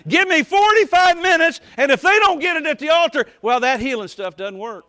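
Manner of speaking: loud, high, shouting preacher at the pulpit; full-force preaching. Real, not acted. Preacher scolding the congregation, in a loud shouting preach. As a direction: hammer it home like a fired-up revival preacher.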